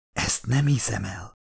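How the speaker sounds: soft, hushed disbelief; whispered. A narrator's reading. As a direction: whisper it under your breath. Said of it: "suttogta a hajóban Meredith Vickers".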